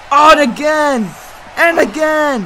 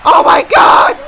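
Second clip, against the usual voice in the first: hype scream; 14 semitones higher, 6 dB louder than usual. Acted. Big move lands.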